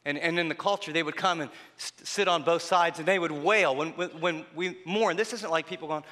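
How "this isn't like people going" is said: ordinary talk, nothing marked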